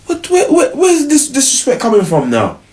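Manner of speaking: put-on high, indignant girlfriend voice; high outraged girlfriend. Girlfriend snapping back at her boyfriend, high and outraged. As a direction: play the offended girlfriend squealing. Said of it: "now it gets to".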